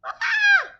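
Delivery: very high loud scream; shriek. A narrator's reading. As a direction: shriek in terror. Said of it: "shrieked Flap".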